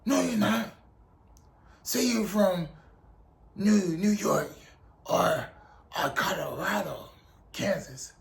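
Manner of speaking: imitated sergeant refusing to believe a recruit; put-on drill instructor voice, not shouted; Drill Sergeant Kickass. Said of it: "He was like".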